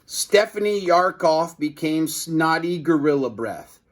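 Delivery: ordinary delivery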